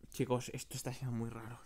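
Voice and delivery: low, soft mock-grave delivery; ominous unease. Gamer confiding the strangeness to viewers, ominously. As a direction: drop down low in feigned dread.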